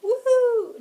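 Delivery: high, loud cheer; a burst of fan excitement; excited whoop. Real, not acted. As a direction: whoop it out with glee. Excited cheer about the movie.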